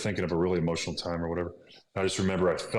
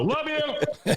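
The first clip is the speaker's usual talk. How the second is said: much higher sing-song love you; playful call-out. Real, not acted. Teasing his wife who is listening nearby, high sing-song. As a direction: toss it off fondly to her.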